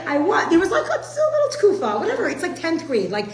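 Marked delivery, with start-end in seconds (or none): 0.1-2.3 laughing admission. Real, not acted.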